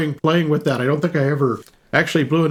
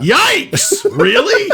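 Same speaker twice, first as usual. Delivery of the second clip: high, loud yelp of shock; startled disbelief; 11 semitones higher, 4 dB louder, much slower than usual. Real, not acted. Host reads listener's grim film pick, startled yelp. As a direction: blurt it out, aghast and amused.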